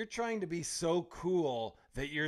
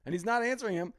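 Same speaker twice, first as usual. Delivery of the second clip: ordinary talk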